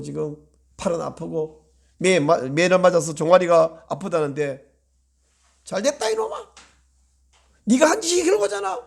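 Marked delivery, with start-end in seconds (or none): 5.6-8.8 high taunting voice of a gloating father; mocking father. Pastor imitating a father gloating over his punished child.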